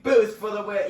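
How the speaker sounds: high, loud triumphant call; excited cheer. Real, not acted. Gamer boosting to the finish, excited cheer. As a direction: shout it as you boost.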